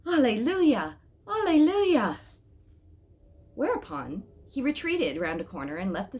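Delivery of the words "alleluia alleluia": loud, high rising cries of praise; joyful exclamation. A narrator's reading. Watchman hailing the angel at sunrise, loud joyful cry. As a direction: call out alleluia to the sunrise with arms raised. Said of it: "raised his arms to the sunrise and exclaimed".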